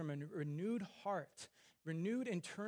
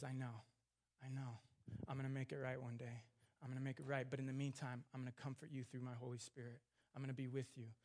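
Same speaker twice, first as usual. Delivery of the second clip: soft, lower, tender reassurance; gentle comforting; 3 semitones lower, 5 dB quieter than usual. Acted. Preacher voicing God to the grieving, soft tender reassurance. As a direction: speak as a comforting father, softly.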